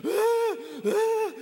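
hammed-up crying sounds; mock loud sobbing. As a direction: wail out like a grown man crying in public. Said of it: "a grown man going".

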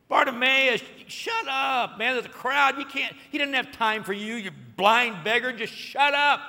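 high, loud scolding; scolding crowd. Retold or imitated. Crowd scolds the blind beggar, loud annoyed. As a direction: tell the beggar off, shut him up.

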